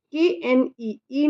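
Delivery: ordinary delivery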